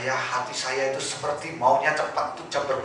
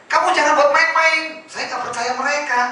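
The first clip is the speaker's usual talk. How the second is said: worried wife; 11 semitones higher, 5 dB louder than usual. Retold or imitated. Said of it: "Istri saya".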